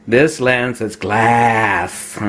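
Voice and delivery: low, very slow mock Aussie; Australian accent. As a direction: stretch it out in a butchered Australian accent. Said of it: "my use of an Australian accent".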